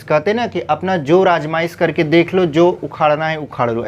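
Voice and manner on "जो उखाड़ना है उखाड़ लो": ordinary talk, nothing marked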